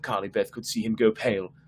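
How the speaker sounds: ordinary delivery, nothing marked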